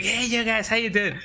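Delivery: high, bright mock-cheerful hello; sing-song fake-happy 'hey guys'; overly cheery greeting. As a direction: breeze in like nothing's wrong, hammed-up chipper. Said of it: "come in here and be like".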